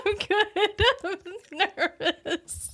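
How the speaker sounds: high, giggling through it; laughing. Real, not acted. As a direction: hold back laughter. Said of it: "now I can't stop laughing".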